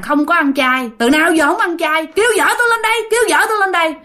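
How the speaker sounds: retold yelling, pitch rising into the demand; imitating angry outcry. Ghost in nephew demands his wife come, high insistent outcry. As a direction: climb to a high, demanding cry. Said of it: "xong rồi la làng lên".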